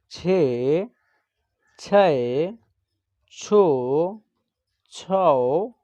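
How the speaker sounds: each syllable drawn out alone, with long pauses between; barakhadi recitation. Shown as a demonstration. Teacher reciting Hindi syllables to students as a slow classroom chant. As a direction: chant the letters slowly for children to repeat.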